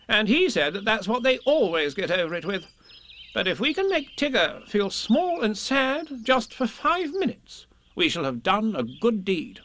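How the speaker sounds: high, emphatic lecturing voice; bossy, know-it-all Rabbit. Acted. Rabbit justifying the plan to Piglet, self-assured. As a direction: argue the case with great assurance.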